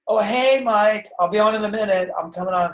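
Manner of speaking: mock a host stalling for viewers; stalling live host. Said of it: "don't sit there and go".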